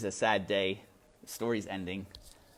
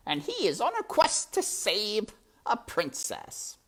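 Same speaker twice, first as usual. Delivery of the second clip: high, purring villain rat, mockingly weary; a higher, silky rat who finds it all tiresome; sly rat voice; 10 semitones higher, 5 dB louder than usual. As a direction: play the sly rat toying with the mouse. Rat Botticelli's voice in story.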